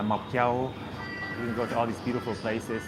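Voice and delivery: ordinary talk, nothing marked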